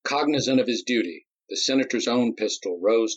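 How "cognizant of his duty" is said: plain reading voice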